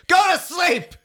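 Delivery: high, loud shouted order. Acted. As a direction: bellow it like a fed-up roommate. Advice column punchline yelled.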